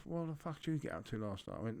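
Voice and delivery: ordinary talk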